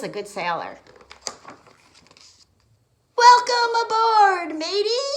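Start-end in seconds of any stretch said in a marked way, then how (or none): 3.2-5.1 high, loud salty captain; captain shouting his welcome aboard. Acted. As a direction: bellow a pirate welcome. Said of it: "Captain Delbert shouted".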